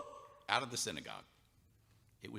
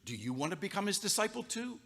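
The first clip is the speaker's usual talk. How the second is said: sarcastic jab; 10 semitones higher than usual. Acted. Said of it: "he was prickly".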